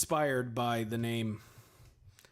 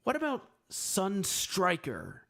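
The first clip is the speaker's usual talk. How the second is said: slow higher idea; 7 semitones higher, slower than usual. Retold or imitated. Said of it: "And I was like".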